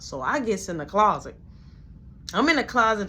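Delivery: ordinary talk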